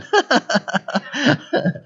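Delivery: high, bubbling run of laughter; real laugh. Real, not acted. Preacher joking to congregation about coffee, laughing. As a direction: laugh freely at your own joke.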